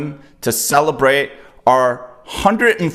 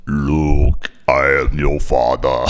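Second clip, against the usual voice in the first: low, slow, menacing masked villain; Darth Vader voice; 9 semitones lower, much slower than usual. Pretend. Cohost doing Vader for the show, low and slow. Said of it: "let's do our Darth Vader voices".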